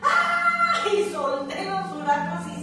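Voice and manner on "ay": sharp high yelp of fright, much higher and louder; retold scream. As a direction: cry out ay in sudden fright. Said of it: "cuando yo grito". (grito agudo de susto)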